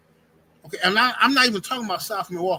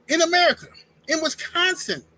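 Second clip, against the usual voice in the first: high-pitched disbelief on each place name; incredulous emphasis; 8 semitones higher than usual. Real, not acted. Storyteller amazed no English is spoken there, incredulous emphasis. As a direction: stress each place as if it cannot be true.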